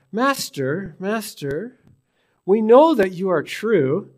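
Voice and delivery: fawning, sweet-talking the teacher; butter him up with a lifted voice; flattering tone. Acted. Jesus's enemies flattering him, fawning and sugary. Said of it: "they're flattering him".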